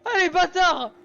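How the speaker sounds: shouted curses, still shaken; loud frightened swearing. Real, not acted. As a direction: yell it out, heart still racing. Swearing after being caught.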